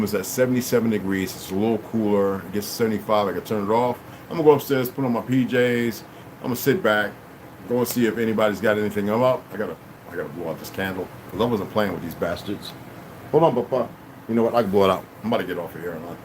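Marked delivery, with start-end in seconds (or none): none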